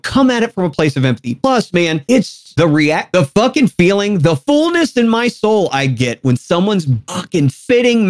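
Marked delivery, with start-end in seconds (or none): none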